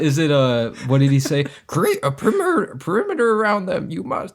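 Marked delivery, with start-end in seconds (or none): 1.7-4.3 high, inverted Yoda speech; squeaky little Jedi master, word order backwards. Retold or imitated. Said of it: "That's a good impression".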